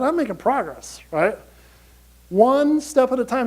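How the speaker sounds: ordinary delivery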